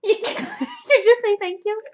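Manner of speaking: real laughing question, loud and high; amused disbelief. Real, not acted. Teasing a friend over her answer, laughing disbelief.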